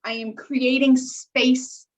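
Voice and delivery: usual speaking voice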